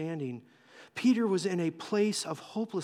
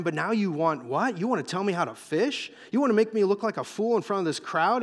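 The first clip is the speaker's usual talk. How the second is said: worked-up, incredulous protest, pitch climbing toward the end; exasperated Peter; 2 semitones higher, 3 dB louder than usual. Acted. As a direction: argue like an affronted expert fisherman. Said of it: "Peter's gotta be, oh man, his heart is going".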